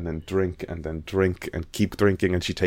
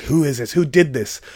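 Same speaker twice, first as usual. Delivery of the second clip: furious demand; 7 semitones higher, 4 dB louder than usual. Retold or imitated. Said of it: "sort of furious".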